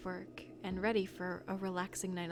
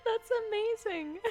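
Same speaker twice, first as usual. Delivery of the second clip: high helpless laughter; laughing hard; 13 semitones higher, much slower than usual. Real, not acted. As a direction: crack up completely. Laughing at fart joke.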